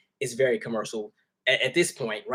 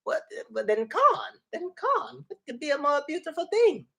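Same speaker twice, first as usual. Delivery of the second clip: put-on tickled little boy; 12 semitones higher than usual. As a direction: playfully mimic a small kid delighted by corn. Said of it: "he was so tickled about that corn".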